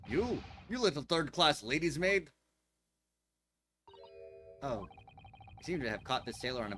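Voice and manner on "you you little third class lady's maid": loud, scornful insult; angry shout. Acted. Sailor yelling at the lady's maid, furious shout. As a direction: bark the insult at her.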